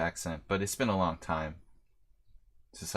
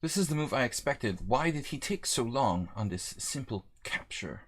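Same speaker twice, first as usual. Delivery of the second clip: lightly hammed-up British accent; 3 semitones higher than usual. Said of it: "Love this new British accent thing".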